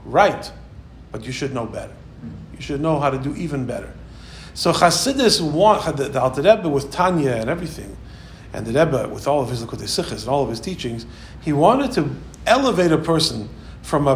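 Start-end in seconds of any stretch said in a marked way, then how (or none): none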